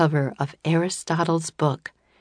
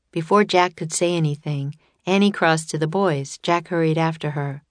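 usual speaking voice

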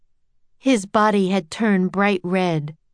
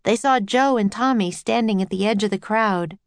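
ordinary delivery

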